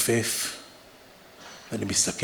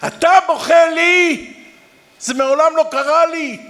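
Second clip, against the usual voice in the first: anguished inner cry; 9 dB louder than usual. Retold or imitated. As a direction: cry out in shock, stretching the words. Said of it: "אני מדבר עם עצמי".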